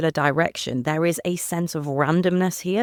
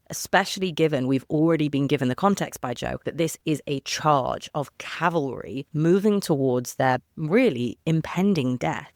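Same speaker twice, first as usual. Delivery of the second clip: ordinary talk, nothing marked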